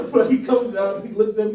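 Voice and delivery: usual speaking voice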